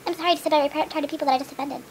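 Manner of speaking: ordinary delivery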